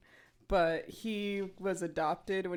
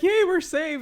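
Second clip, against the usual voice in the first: higher, louder mock cheer; excited cheer; 9 semitones higher, 9 dB louder than usual. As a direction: cheer like saved villagers. Acting out rescued people.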